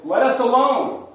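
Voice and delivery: high, loud protest; crying out. Acted. Possessed man in the synagogue to Jesus, crying out. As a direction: cry it out sharply, pitched well up. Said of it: "the man, cried out".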